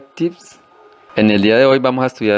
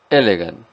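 elegant with the weight on E; stress on first syllable; 2 semitones lower than usual. Shown as a demonstration. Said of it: "fuerza sobre la primera sílaba". (fuerza en la E de elegant)

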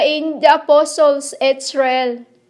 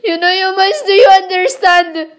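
tearful, higher, pleading; pleading through tears, louder at the end; real crying appeal; crying plea; 5 semitones higher, 4 dB louder than usual. Real, not acted.

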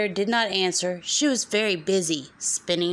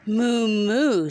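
cow moo; 3 semitones higher than usual. Acted. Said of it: "said the cow".

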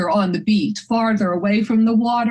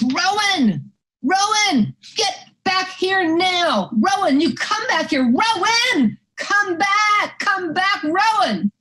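mock yell for a runaway dog, pitched way up; retold scream; 10 semitones higher, slower than usual. Owner calling her dog back from the ocean, retold scream. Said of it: "I scream at the top of my voice".

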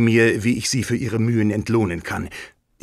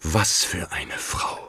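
bewunderndes Flüstern; 4 semitones lower, 4 dB quieter, slower than usual. Acted. Bewunderung nach dem Brief.

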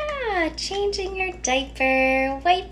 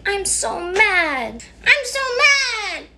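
slow then high, louder stamping; modeled mad; 6 semitones higher, 4 dB louder, slower than usual. Acted. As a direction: show the child how to say it. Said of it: "You can say".

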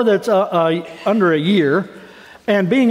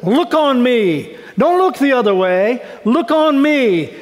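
slow, higher pleading to God; pleading prayer; 5 semitones higher, slower than usual. Retold or imitated. Pastor voicing David pleading with God, slow. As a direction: beg God to look, drawing it out. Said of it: "He says".

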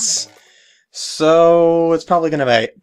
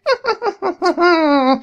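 high villain cackle; evil laugh. Acted. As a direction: cackle with glee. Evil guy laughs at joke.

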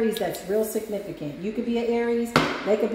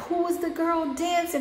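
higher, curious crowd voice; 8 semitones higher than usual. Retold or imitated. Crowd asking about the dancer, curious and higher. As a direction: ask it high like an impressed fan. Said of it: "they be like".

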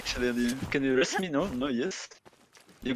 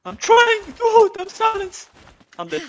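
panicked pleading; 11 semitones higher, slower than usual. Real, not acted. Dying, begging for res.

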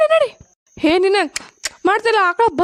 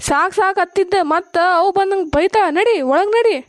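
usual speaking voice

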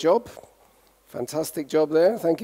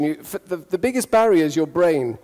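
ordinary talk

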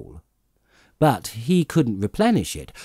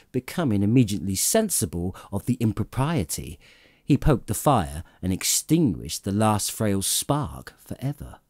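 ordinary delivery, nothing marked

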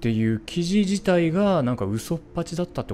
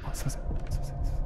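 hushed apology; 8 dB quieter, much faster than usual. Real, not acted. Apologizing for being loud.

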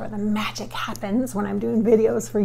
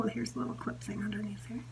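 ordinary talk, nothing marked

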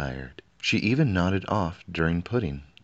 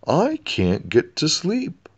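much higher, slow howl; howling king; 10 semitones higher, 5 dB louder, slower than usual. A narrator's reading. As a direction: howl it in frustration. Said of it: "howled the king".

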